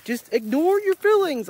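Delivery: high, slow mock Yoda advice. Yoda brushing off Anakin's plea, slow high mock voice. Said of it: "Yoda's like".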